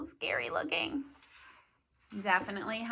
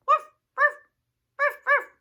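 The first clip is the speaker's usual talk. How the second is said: high, loud yappy woof; puppy barking; 9 dB louder than usual. Pretend. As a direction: bark like a little puppy. Imitating a puppy for kids.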